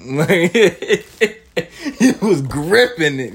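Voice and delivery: real laughter breaking through the words; laughing. Real, not acted. Telling viewers about the brother's grip, laughing for real. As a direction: giggle your way through it. Laughs while describing the gripping.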